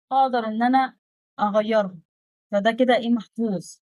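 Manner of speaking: ordinary talk, nothing marked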